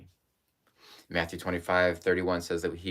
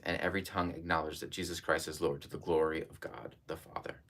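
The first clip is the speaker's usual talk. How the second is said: fading to a whisper; 4 semitones lower, 7 dB quieter than usual. Real, not acted. Scripture reading fading out.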